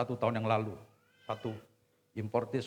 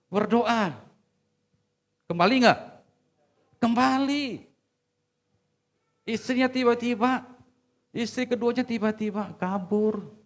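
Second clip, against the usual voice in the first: high, loud preacher's cry; preaching at full force; 12 semitones higher, 10 dB louder than usual. Acted. Preacher crying out to wives in the congregation, high and loud. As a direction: proclaim it to the whole congregation.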